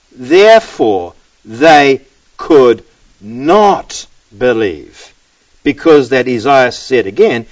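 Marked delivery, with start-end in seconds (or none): 0.1-5.1 very slow, each word set apart; slow, deliberate verse repeat; word-by-word emphasis. Shown as a demonstration. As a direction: spell it out with pauses between words.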